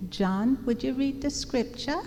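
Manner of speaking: put-on mother's Jamaican accent, lifted at the end; Jamaican-accented mother calling on someone; strong Jamaican accent. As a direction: imitate a Jamaican mom, pitch climbing. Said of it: "she had a strong Jamaican accent".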